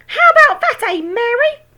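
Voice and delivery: very high, loud crone call; shouting grandma. A narrator's reading. Grandma shouting from the roof at George's mother. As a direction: crow it at her. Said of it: "Grandma shouted".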